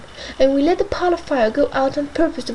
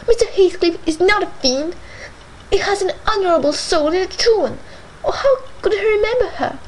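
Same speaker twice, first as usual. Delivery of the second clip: tearful lament; 5 semitones higher than usual. A narrator's reading. Isabella lamenting to Nelly through tears. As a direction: pour out hurt through tears. Isabella weeping after Catherine leaves.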